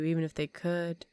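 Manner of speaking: ordinary talk, nothing marked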